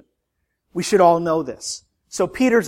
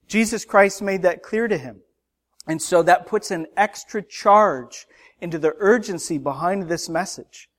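usual speaking voice

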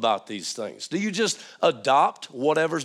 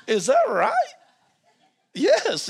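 laughing. Real, not acted. Laughing at his own spelling.

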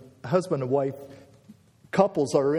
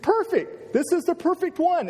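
high, excited raving; acted-out delight, pitched way up; thrilled admiration. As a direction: gush over the perfect one. Acting Adam's delight at seeing Eve.

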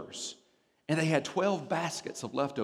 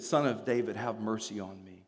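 hushed plea; 7 semitones lower, 6 dB quieter than usual. Retold or imitated. Quotes the blind man's plea.

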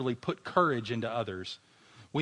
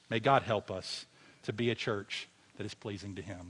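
ordinary talk

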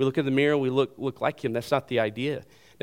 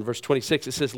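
usual speaking voice

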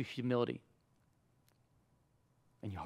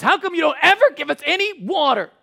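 high, loud mock whine; self-righteous whining; 11 dB louder than usual. Thirsty Israelites blaming Moses, self-righteous mock whining. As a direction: complain like an indignant kid, hammed-up. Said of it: "the whining. It's the unbelievable sense of, like, self-righteousness".

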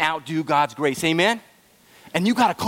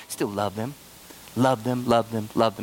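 tender, soft love them; 8 semitones lower, 4 dB quieter than usual. Real, not acted. Tender repeat to parents.

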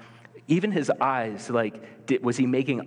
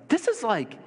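incredulous; 8 semitones higher than usual. Retold or imitated. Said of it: "I'd look at the map and be like".

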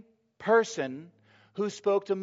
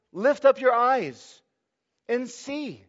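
higher, louder and slow; rousing command; 7 semitones higher, 5 dB louder, slower than usual. Acted. Jesus urging the disciples to look, loud and slow. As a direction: lift them up with a ringing call.

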